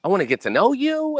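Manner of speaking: high, drawn-out fake gushing; mock gushing scammer. Scammer sweet-talking a stranger online, mock gushing. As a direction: put on a high-pitched, overeager sweet-talker. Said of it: "And they're like".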